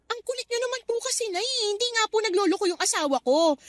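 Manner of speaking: high, pleading exasperation; fed up, push back at mom; exasperated protest. Acted. Daughter fed up with her mother's accusations, exasperated.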